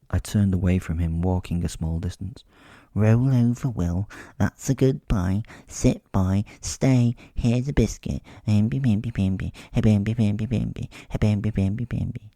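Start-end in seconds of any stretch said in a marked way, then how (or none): 2.9-12.3 talking to him like a pet, sing-song; mocking dog talk. A narrator's reading. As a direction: coo at him like training a dog.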